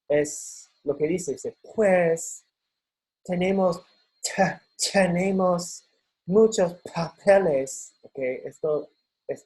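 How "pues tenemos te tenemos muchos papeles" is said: put-on higher, slow Spanish with a North American accent. Teacher to class: imitating an American speaking Spanish. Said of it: "tiene un acento norteamericano".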